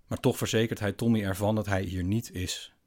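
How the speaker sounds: ordinary delivery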